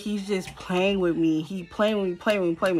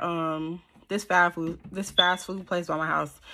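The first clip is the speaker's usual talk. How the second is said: normal talking voice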